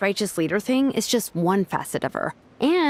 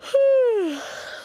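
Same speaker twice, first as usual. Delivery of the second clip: long drowsy sigh, higher; a tired sigh drifting into sleep; sleepy sigh; 12 semitones higher than usual. Acted. Sleepy sigh before dozing.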